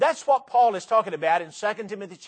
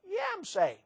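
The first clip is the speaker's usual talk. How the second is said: believer; 13 semitones higher, 6 dB quieter than usual. Acted. Acted exchange about salvation.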